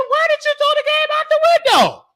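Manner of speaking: very high outraged question; shocked kid. Retold or imitated. Kid asking mama why she threw the game, very high. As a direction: squeal it in disbelief.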